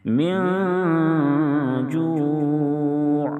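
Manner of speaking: high, slow melodic chant; tajweed recitation, high and drawn out; Quranic recitation. Acted. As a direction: recite slowly with a sung, held melody. Recited correct model.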